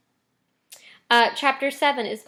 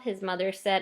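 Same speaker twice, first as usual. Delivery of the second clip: usual speaking voice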